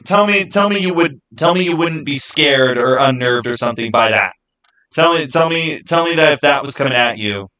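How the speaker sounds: usual speaking voice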